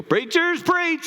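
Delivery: calling out high and excited; mock congregation shout-out, pitched high. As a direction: cheer the preacher on. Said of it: "you go".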